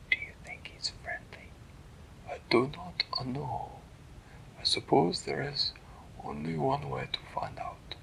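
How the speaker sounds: soft hushed storytelling voice; whispered bedtime story. A narrator's reading. Parent reading a bedtime story, hushed whisper. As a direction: breathe the story out softly to a sleepy child.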